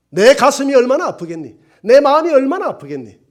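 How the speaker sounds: high, loud pleading cry of a grieving God; God's heartbreak, voiced loud and high; anguished cry. God's voice crying out.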